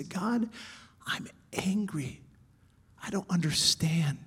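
soft whisper, honest and hurting; whispered prayer. Shown as a demonstration. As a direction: breathe it to God in a whisper. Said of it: "We could say".

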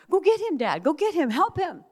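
put-on high, loud little-girl cry to her dad; a four-year-old shouting at the window; urgent child plea. Child urging dad to help brother.

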